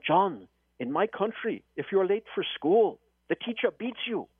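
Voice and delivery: put-on a light African accent with rising pitch. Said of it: "I'm gonna feign his African accent".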